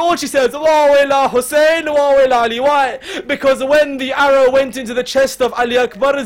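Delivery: loud, high mourning cry; full-force grieving preacher; wailing lament. Acted. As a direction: wail over the fallen body. Zainab's lament, preached at peak.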